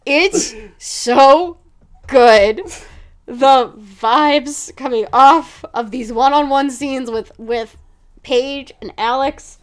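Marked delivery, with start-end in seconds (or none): 0.1-7.1 big loud gush. Real, not acted. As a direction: belt out how good it is. Gushing over the show.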